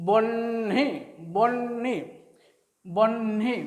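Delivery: "বহ্নি" split in two, long n then hi; n before h. Shown as a demonstration. Teacher showing how it sounds, বহ্নি with n ahead of h. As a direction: say বহ্নি as "bonn-hi", n held. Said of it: "উচ্চারণটা কেমন হচ্ছে?".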